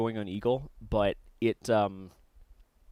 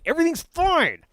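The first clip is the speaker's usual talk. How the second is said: much higher, louder mock-panicked cry; 8 dB louder than usual. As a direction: squeak it out in fake alarm. Mock-panicked reprise of line.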